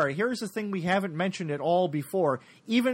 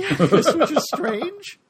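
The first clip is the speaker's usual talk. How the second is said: high, slow words through a laugh; 9 semitones higher, 7 dB louder, much slower than usual. Real, not acted. Laughing repeat of the remark.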